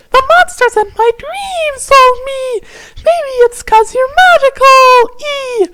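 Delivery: very high falsetto fairy voice; squeaky fairy. Acted. Fairy explaining to Tavros about her dreams, squeaky high.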